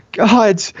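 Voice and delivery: high gasped cry, shaking; shuddering gasp. A narrator's reading. Princess gasping alone by the door. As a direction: gasp it out, trembling. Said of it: "I said in a shuddering gasp".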